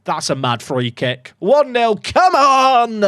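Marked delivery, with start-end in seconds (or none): 1.4-3.0 punchy celebratory cheer; excited cheer. Real, not acted. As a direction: cheer the goal. Celebrating a goal.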